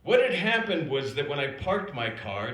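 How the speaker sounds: usual speaking voice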